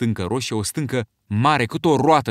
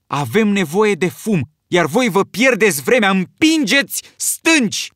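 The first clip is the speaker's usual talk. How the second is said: high, loud, slow scolding; angry shout; 8 semitones higher, 6 dB louder, slower than usual. A narrator's reading. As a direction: lash out at the time-wasters. Ralph angry at the boys.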